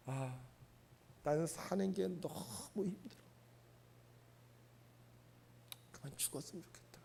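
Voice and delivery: faint, breathy voice sinking to whisper; barely able to speak from despair. Retold or imitated. Preacher voicing a weary person who wants to die. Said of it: "힘이 든 사람은요".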